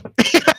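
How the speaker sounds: a sudden high burst of disbelieving laughter; squealing laugh of disbelief; high incredulous laugh. Real, not acted. Laughs at villains let go.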